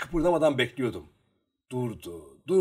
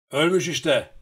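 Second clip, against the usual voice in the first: loud, higher, sharp cry; sharp shout; 5 semitones higher, 8 dB louder than usual. A narrator's reading. Lukaşka announcing to the Cossacks from the shallows, sharp shout. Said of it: "diye bağırdı keskin bir sesle".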